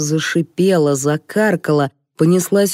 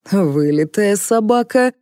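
higher, impressed exclamation; delighted admiration; 4 semitones higher than usual. A narrator's reading. Director sees Darya in the dog costume, delighted. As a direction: admire it with enthusiasm. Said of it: "восхитился Гриша".

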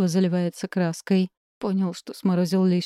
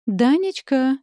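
a high, raised call across the room; 6 semitones higher, 3 dB louder, slower than usual. A narrator's reading. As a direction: call her son's name, alarmed. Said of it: "кричит она".

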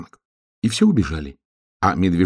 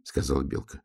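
ordinary delivery

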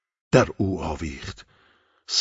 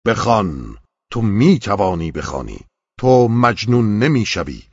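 loud, stern, rising call to read; commanding shout; 4 semitones higher, 6 dB louder than usual. A narrator's reading. The angel shouts at the trembling man, loud and stern. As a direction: thunder the command like a rebuke. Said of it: "با عتابی ... فریاد زد".

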